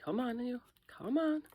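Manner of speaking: coaxing. Real, not acted. Coaxing the stuck mold.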